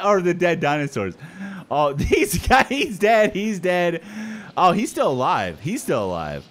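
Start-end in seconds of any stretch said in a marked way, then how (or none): none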